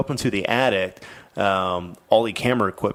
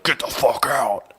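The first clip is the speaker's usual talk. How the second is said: slow, forceful ghostly command, retold; menacing old man; 4 semitones higher, 3 dB louder, slower than usual. Old man ghost to the frightened mom, menacing. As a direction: imitate an old man's ghost ordering her out. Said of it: "the old man would appear before her".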